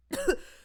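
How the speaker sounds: a harsh, high hacking cough; a drunk man's fit of coughing; acted coughing fit. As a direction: cough as if you can't stop.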